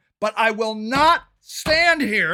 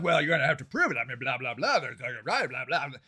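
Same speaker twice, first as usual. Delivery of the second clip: mock dismissive cop; 13 semitones lower, 9 dB quieter, faster than usual. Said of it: "you call the police and you're like".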